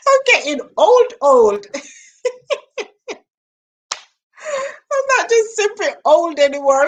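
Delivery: laughing high through the words; giggling at her own forgetfulness. Real, not acted.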